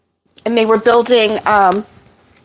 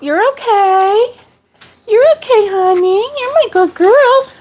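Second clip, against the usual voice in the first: much higher cooing voice to the dog; 11 semitones higher than usual. Real, not acted. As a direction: coo way up high to soothe her. Comforting the scared dog.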